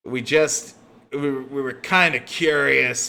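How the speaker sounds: a nervous henchman fumbling an excuse; thug Tom. Acted. GM voices thug Tom explaining.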